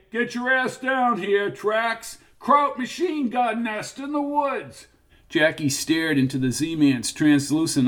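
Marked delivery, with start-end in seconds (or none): 0.1-4.9 high, distant shout. A narrator's reading. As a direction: yell it up from the grove. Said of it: "Somebody shouted".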